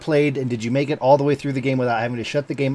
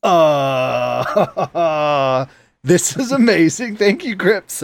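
laughing relief; 2 semitones higher, much slower than usual. Retold or imitated. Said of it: "I'm like".

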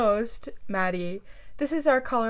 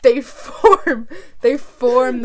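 laughing; 10 dB louder, much slower than usual. Real, not acted. Laughing through cow explanation.

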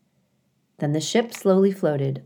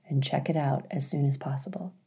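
playful whisper; 3 semitones lower, 7 dB quieter, faster than usual. Real, not acted. Host urging listeners playfully.

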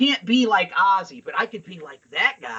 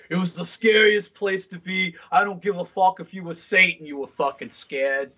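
put-on New York accent. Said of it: "That New York accent".

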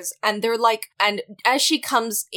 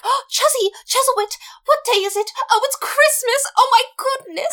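very high, breathless giddy gush; manic gushing; 4 dB louder than usual. Retold or imitated. Retelling the woman's manic morning, high giddy gush. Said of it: "this woman has a mental break".

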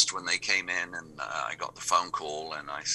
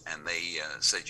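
ordinary delivery

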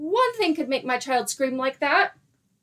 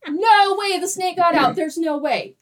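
higher, louder cry of disbelief; 6 semitones higher, 5 dB louder than usual. Retold or imitated. Mom hearing the snake got out, loud disbelief. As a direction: refuse to accept it got out.

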